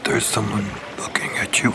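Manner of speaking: secret whisper, leaning in close. Acted. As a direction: point him out in a hushed voice. Whispering to Gip in-character.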